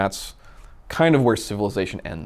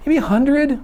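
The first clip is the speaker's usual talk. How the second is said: much higher and louder mock mom voice; imitating mom; 6 dB louder than usual. Mom guessing her fastest speed ever, high and loud mock voice. Son asks mom on motorcycle.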